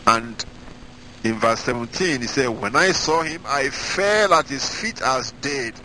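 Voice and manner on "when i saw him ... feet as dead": raised, slow solemn reading of scripture. Acted. Preacher to congregation, reading Revelation in solemn raised voice. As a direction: read the verses out like holy proclamation.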